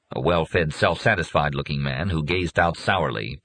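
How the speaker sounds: ordinary delivery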